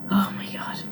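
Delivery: breathless whisper of dread; whispered oh my God. Real, not acted. Remembering the toddler who would not wake, a whisper. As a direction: whisper it under your breath. Groaning at the early alarm.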